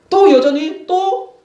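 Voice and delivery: 또 여전히 또 said slow and high in wonder; drawn-out emphasis. Real, not acted. Preacher stressing God's patience.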